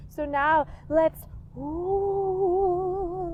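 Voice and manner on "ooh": ghost ooh. Pretend. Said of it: "like a ghost".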